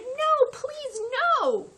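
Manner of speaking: high, loud begging cry; boy begging the bear to stop; frightened pleading. Acted. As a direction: plead in panic. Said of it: "Louis shouted".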